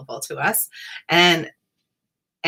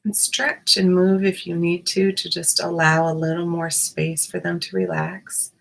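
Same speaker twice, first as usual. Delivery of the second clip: guided meditation. Acted. Guided meditation body scan.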